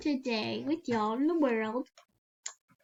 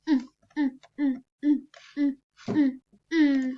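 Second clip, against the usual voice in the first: model humming; 7 dB louder than usual. Pretend. Posing as a model.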